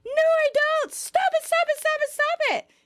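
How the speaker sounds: very high, frantic squeal; panicking. A narrator's reading. Chandler jumps up and down in denial, panicking. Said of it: "panicking more and more".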